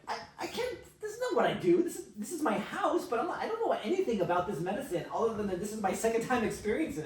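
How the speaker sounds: high, loud, stammering refusal; flustered protest. Retold or imitated. Reluctant helper objecting to being picked for the job, flustered protest.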